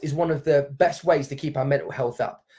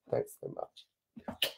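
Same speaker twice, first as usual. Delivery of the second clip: barely voiced, breaking thanks; whispering through happy tears; choked whisper; 14 dB quieter, much slower than usual. Real, not acted. Thanking a friend on the live stream, choked up. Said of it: "electronic tissue".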